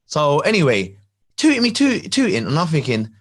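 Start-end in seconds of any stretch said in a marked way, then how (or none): none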